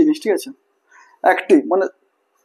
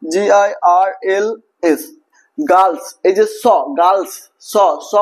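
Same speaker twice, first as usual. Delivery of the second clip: very slow letter-by-letter spelling; much slower than usual. Shown as a demonstration. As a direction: spell it out slowly for the class. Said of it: "spelling letter by letter".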